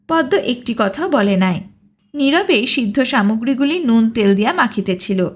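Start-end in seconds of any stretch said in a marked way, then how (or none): none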